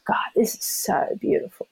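softly whispered wonder; awed whisper. Retold or imitated. Runner recalling a beautiful race moment, whispering in awe. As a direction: breathe it out in awe. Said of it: "and I thought".

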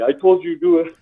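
lightly imitating a Russian accent. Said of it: "in his Russian accent".

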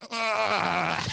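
stifled cry of pain into the pillow; muffled pain cry. Retold or imitated. Man hiding pain during massage, stifled cry. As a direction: hold in a cry as she digs in. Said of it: "I'm just there like".

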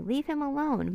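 high, swooping storybook protest; mild acted yell; pleading yell. A narrator's reading. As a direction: cry out in his defense, high. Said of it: "yelled Annie".

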